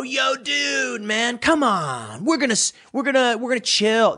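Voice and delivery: hammed-up surfer-bro pitch to a businessman; mock stoner bro. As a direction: lay on the lazy bro voice. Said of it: "you walk up to him and go".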